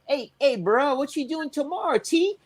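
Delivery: lightly imitating a Cajun friend's accent. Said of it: "real thick accent".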